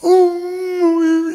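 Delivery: very high, loud imitated howl; excited dog howl. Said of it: "And the dog goes".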